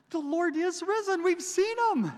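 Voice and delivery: high, loud, breathless joy; excited announcement. Retold or imitated. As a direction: burst in with the good news. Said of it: "they said".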